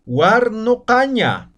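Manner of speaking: guarnocaña drawn out clearly with an o sound; slow pronunciation demo. Shown as a demonstration. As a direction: spell out guarnocaña for learners, u opened to o. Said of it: "Otro ejemplo".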